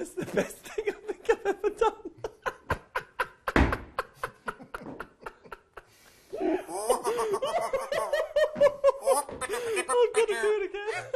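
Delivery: laughing with delight at herself. Real, not acted. First swazzle success, delighted.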